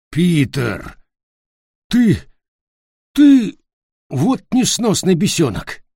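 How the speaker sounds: rising to a high, loud yell; sputtering rage at the prankster; startled furious outburst. Acted.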